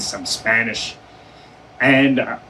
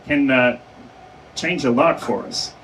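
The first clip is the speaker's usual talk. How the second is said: ordinary talk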